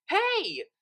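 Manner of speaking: loud high hail across the path; calling out. Acted. Lyra hailing Octavia from down the path, loud and high. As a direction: call out to stop her. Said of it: "called Lyra".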